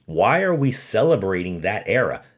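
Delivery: ordinary talk, nothing marked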